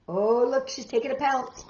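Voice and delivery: plain reading voice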